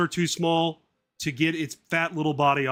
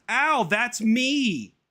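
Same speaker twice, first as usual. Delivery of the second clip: very high, very slow whiny ow; squeaky complaint; 9 semitones higher, 4 dB louder, much slower than usual. Acted. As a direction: whine it at mum in a squeak. Son complaining.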